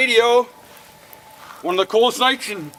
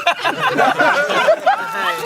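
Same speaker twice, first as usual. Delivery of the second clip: laughing; 14 semitones higher, 3 dB louder than usual. Real, not acted.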